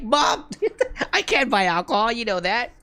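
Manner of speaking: high-pitched protest through laughter; mock protest. Acted protest to mom's accusation.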